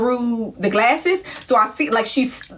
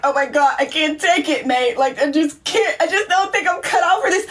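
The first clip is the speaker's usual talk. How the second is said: crying friend; 4 semitones higher than usual. Retold or imitated. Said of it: "she like".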